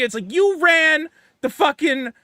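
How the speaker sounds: ordinary talk, nothing marked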